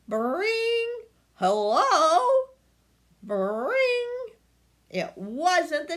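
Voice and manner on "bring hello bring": high bell with a loud hello; doorbell ring. A narrator's reading. Narrator voicing doorbell, high rings.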